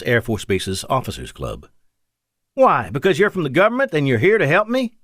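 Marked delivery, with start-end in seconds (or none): 2.5-4.9 put-on thickened hillbilly accent. A narrator's reading. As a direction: lay on a soft hillbilly accent, pitched up. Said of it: "Victor thickened his soft hillbilly accent".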